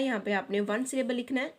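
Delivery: ordinary delivery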